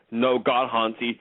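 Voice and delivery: usual speaking voice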